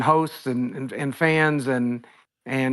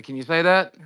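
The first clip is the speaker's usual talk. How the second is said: usual speaking voice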